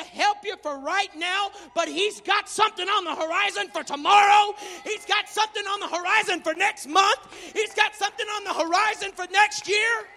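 loud, rising preacher's cry; fiery pulpit shout; preaching at full force. Acted. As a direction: preach it out to the congregation.

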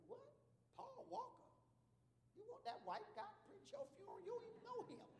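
high, disbelieving questions; can't believe what he's hearing; incredulous. Retold or imitated. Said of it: "I said".

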